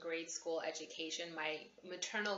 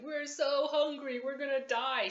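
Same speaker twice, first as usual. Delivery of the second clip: put-on whiny kids; 8 semitones higher, 6 dB louder than usual. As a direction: drag it out like a dramatic hungry kid. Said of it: "anytime we were like".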